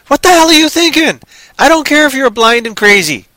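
ordinary talk

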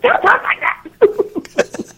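very high, stretched-out mocking scold; snippy. Annoyed coworker interrupting the Southern act, imitated as snippy. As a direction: imitate the snippy woman, laughing through it. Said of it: "snippy thing".

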